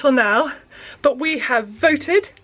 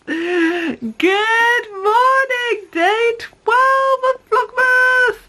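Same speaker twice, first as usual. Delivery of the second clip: high, slow sing-song cooing; 10 semitones higher, much slower than usual. Real, not acted. Cooing greeting to baby.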